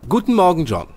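loud, high, bright good morning; cheerful greeting. Acted. Sherlock greets John over tea in the morning, cheerful and loud. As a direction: greet him like the day is already won. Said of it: "ein ausgesprochen gut gelaunter Sherlock".

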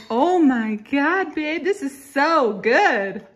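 high, loud, slow gushing delight; over-the-top excited. Pretend. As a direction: gush like it's the best meal ever. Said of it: "you're supposed to be jumping up and down saying".

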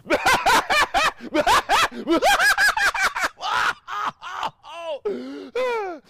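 a long burst of real high laughter. Real, not acted. Speaker loses it laughing after the channeling story. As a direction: break up laughing, loud and high. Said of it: "Pardon me just a moment".

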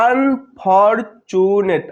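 "Unfortunate" very slowly in four separate beats; syllable by syllable. Shown as a demonstration. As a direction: stretch out each syllable of "unfortunate" with gaps. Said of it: "চারবারে উচ্চারিত হচ্ছে".

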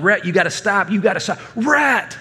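mock yell building to a high-pitched call; retold yelling. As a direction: call after a runaway grandson, rising to a shout on the name. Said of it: "And I'm yelling".

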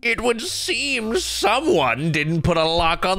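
slow, menacing drill sergeant. Retold or imitated. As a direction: drag it out slowly like a drill sergeant. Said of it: "The look they get".

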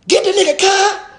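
high-pitched hammed-up yell, laughing; mock yelling attacker. Mocking the attacker who screamed during the jumping, high yell. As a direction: shriek it like a hyped attacker. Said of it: "You screaming".

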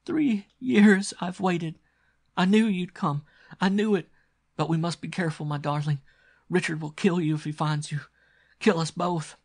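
high-pitched, overwrought female character; sobbing. Acted. Captive girl reunited with her lover, sobbing. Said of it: "the girl was sobbing".

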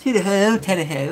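high, loud hammed-up posh English; mock posh British. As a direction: squeak it like a cartoon toff. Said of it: "that is the standard go-to".

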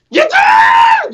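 sudden very high shout. Retold or imitated. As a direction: yell it out of nowhere.

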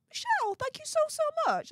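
very high-pitched quoted thank-you; high, gushy gratitude; gushing thanks. Retold or imitated. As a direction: squeal the thanks as a self-quote. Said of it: "I'm like".

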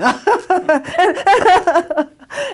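high loud burst of laughter; big laugh. Real, not acted. Speaker laughing after retelling elders' scolding.

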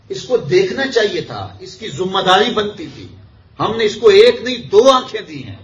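high, loud sermon rebuke; impassioned preaching. Real, not acted. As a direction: raise the voice in fervent reproach.

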